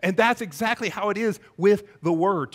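ordinary talk

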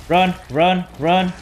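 loud panicked run calls; being chased. Real, not acted. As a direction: yell it while fleeing. Fleeing a monster.

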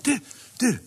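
high calling vänta. Acted. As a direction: stop him leaving, urgently. Baloo calls Mowgli back. (ropar högt vänta)

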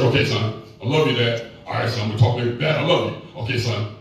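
low, reluctant fatherly voice, voiced by son; a hint of a gruff old dad dodging the words; father's awkward reply. Dad stiffly answering his son's I love you, low reluctant voice.

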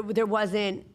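ordinary talk

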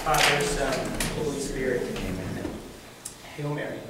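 low, subdued prayer recitation; measured Hail Mary recitation; communal prayer. Real, not acted. Leads a prayer.

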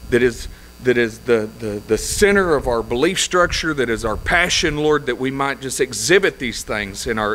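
low, hushed pastor's prayer; low murmured prayer voice. Acted. Opening prayer led from pulpit.

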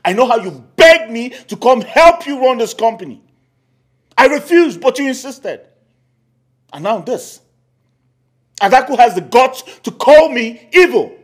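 aggrieved outburst. Acted. Uncle complains to sister.

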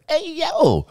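high, loud sound-button call; high shouted catchphrase drop; hey yo button. Shown as a demonstration. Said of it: "that's the way I did the "Hey, yo" button".

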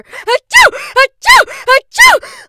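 huge high achoo; explosive sneeze; loud sneeze. A narrator's reading. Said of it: "The sneezes continued".

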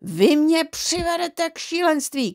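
high, slow exasperated cry; angry shout. A narrator's reading. Father fed up with the family, exasperated shout. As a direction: cry out as if driven mad. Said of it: "křičel tatínek".

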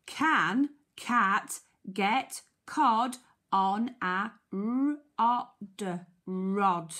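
very slow, each word set apart; word-by-word reading. Shown as a demonstration. Teacher helping children decode the question, word-by-word reading. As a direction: sound out "rod" slowly, then blend it.

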